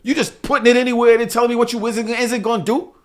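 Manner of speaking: high, indignant complaint; exasperated retold scolding. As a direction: act out telling the driver off. Retells scolding the driver.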